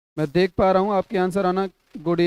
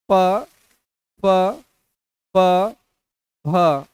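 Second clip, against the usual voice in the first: emphatic demo; 4 dB louder, much slower than usual. Shown as a demonstration. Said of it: "teacher demonstrating labial sound".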